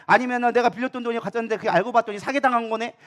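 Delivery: usual speaking voice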